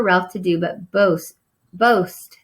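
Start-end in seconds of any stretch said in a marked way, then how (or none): none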